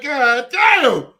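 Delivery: high, loud exasperated curse. Retold or imitated. Coach reacting to players not caring, loud frustrated curse. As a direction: let it out in disbelief. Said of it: "I'm like".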